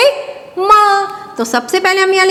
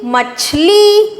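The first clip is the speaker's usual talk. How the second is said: slow, higher drawn-out word; sounding out words; 4 semitones higher, much slower than usual. Shown as a demonstration. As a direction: stretch it out with a lift. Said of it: "तो क्या शब्द बन गया?".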